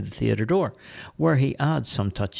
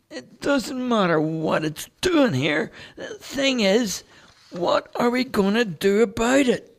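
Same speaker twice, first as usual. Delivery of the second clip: Dale's voice; 14 semitones higher than usual. Acted. Homeless Dale turning down the priest, high character voice. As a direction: play the street artist, eager and chatty.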